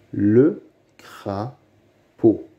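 "Le crapaud" very slowly, split by long pauses; syllable by syllable. Shown as a demonstration. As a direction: sound out "Le crapaud" beat by beat. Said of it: "Lis les mots". (« Le crapaud » très lentement, syllabe par syllabe)

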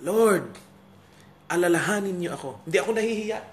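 imitated shout of unashamed faith; acted crying out. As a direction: call out boldly as the condemned man. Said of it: "Basta't sumisigaw lang siya".

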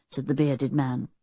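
ordinary delivery, nothing marked

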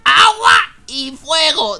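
slow, drawn-out loud shout; amazed shout. Real, not acted. Repeats it in amazement.

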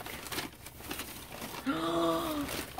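a soft high gasp of delight. Real, not acted. Gasping as she unwraps the handmade doll. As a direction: catch your breath at the gift. Seeing the gift.

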